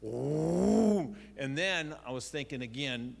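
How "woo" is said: mock engine whoosh, loud and high; car engine roar. Kid recalls neighbor lady's car gunning round the corner, engine whoosh. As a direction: imitate the big car gunning it. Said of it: "that sound of the car".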